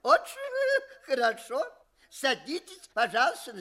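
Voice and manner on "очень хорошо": high, chuckling through the words; laughing praise. Acted. Examiner laughs and praises the student, chuckling. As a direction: praise him while still laughing.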